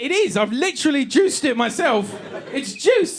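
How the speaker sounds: high-pitched protest; indignant insistence. Acted. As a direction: argue back in a rising squeak. Boyfriend arguing back that it's juice.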